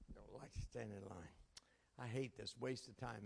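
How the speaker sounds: low muttered complaint, half whispered; grumbled under breath. Retold or imitated. Impatient preacher muttering in a grocery line, whispered. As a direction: grumble it to yourself so no one hears.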